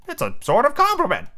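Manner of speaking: very high sidekick voice; high-pitched henchman; Smee. Acted. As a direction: offer it high and hopeful. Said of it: "said Smee".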